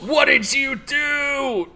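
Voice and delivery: high, drawn-out, hammed-up accusation; exaggerated mock demand. Cohost parodying the aggressive question, exaggerated demand. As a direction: wail the question slowly in mock outrage.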